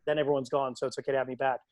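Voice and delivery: ordinary talk, nothing marked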